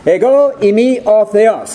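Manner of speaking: Ego eimi o theos slow, in modern Greek: EH-go EE-mee o theh-OS; modern Greek pronunciation. Shown as a demonstration. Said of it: "I'm using the modern pronunciation".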